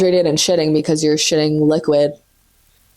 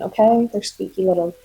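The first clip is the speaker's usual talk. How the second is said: usual speaking voice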